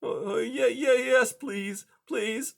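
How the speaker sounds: high, timid plea; hesitant high pleading voice; pleading mouse. A narrator's reading. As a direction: beg like a frightened little mouse. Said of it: "Blinker pleaded".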